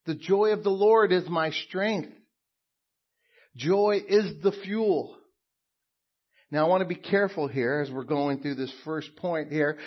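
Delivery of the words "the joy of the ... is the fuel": loud, high preacher's proclamation; preaching at full force. Acted. Pastor declaring to the church, loud and ringing. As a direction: proclaim it to the congregation.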